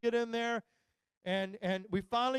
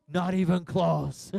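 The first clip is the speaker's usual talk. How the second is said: slow deadpan; 5 semitones lower, 6 dB louder, much slower than usual. Retold or imitated. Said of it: "parent reply, slow".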